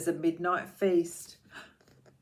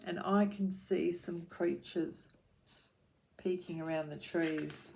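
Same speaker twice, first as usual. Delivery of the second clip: ordinary delivery, nothing marked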